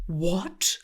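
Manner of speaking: normal talking voice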